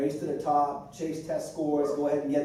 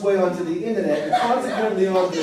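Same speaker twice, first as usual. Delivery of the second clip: loud reading in a Boston accent; 4 semitones higher, 6 dB louder than usual. Shown as a demonstration. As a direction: read it out with a thick Boston accent. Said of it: "Can I try to read with a Boston accent".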